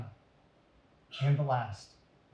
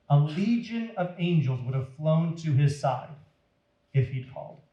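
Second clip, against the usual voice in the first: usual speaking voice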